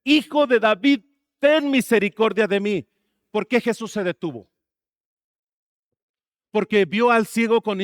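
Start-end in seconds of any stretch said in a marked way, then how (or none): 0.0-2.9 imitated shout, higher and louder; insistent retold plea, voice raised; much louder cry. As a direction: cry out harder, as the blind man silenced by others. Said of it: "Mucho más fuerte".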